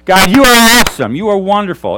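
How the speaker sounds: loud, high cheer to God; making noise. Shown as a demonstration. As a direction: shout praise over the clapping. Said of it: "It's making noise".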